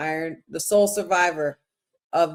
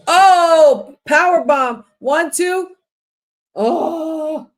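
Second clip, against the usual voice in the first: high, loud burst of excitement; excited shout; 8 semitones higher, 7 dB louder, much slower than usual. Real, not acted. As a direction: yell out the near fall. Live reaction to a near fall.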